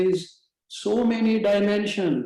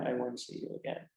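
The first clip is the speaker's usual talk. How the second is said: repeated much softer and lower, fading into a farewell; quiet repeat; 5 semitones lower, 10 dB quieter than usual. Retold or imitated. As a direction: let the farewell sink into near hush. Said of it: "And she said".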